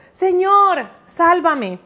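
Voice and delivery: loud, high cry for help, like a man sinking; desperate cry. A narrator's reading. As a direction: shout "Señor" as if going under, then plead. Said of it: "empezó a hundirse y gritó".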